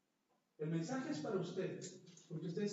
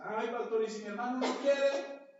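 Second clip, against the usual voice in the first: higher, louder pleading complaint; whiny objection; 3 semitones higher, 7 dB louder than usual. Retold or imitated. Congregant objecting to the pastor, whiny. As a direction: whine a protest at the pastor.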